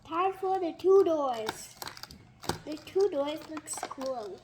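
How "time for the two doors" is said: loud, slow excited announce; excited announcement. Real, not acted. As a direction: announce it with a flourish. Announcing next toy.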